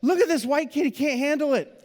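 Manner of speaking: high, teasing amusement; mocking amusement. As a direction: tease the kid who can't take heat. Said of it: "they're just like".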